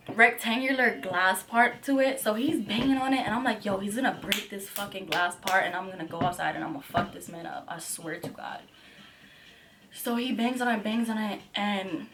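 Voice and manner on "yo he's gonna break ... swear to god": softer, low seething threat; quiet seething threat; low muttered threat. Retold or imitated. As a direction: threaten under breath. Quiet threat while he bangs.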